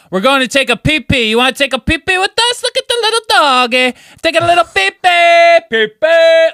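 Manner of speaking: put-on high, loud sing-song baby talk to a dog; drunk baby talk. Drunk friend cooing at a stranger's dog. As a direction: coo at the little doggy like a toddler. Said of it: "like, screaming like that".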